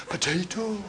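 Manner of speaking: "potato" ganz leise, walisisch gedehnt; flüstere fast "potato" mit walisischem Singsang; Welsh "potato". Shown as a demonstration. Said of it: "we're the only people in the world who say".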